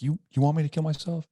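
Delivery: retold challenge, high and loud; confrontational. Husband challenges wife at the door, confrontational retelling. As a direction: re-enact a heated, high-pitched dare.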